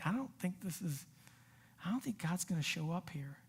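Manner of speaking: hushed, acted doubting thought; quiet doubt. Believer in a moment of doubt, quiet and unsure.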